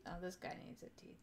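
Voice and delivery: soft unvoiced whisper to self. Real, not acted. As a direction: murmur it privately. Whispered aside.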